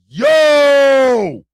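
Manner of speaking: loud, high drawn-out exclamation; shocked shout. Real, not acted. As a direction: cry out in disbelief, stretching the vowel. Exclaims at chat's story.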